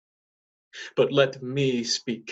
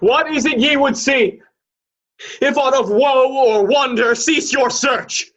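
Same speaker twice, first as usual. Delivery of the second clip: loud challenge; 10 semitones higher, 6 dB louder than usual. Acted. Horatio confronting the arrivals.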